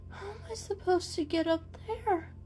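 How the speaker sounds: high disbelieving question; aghast at the height; dismayed. Acted. Mister Fluffal staring up at the cabinet, dismayed. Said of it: "His jaw slacked".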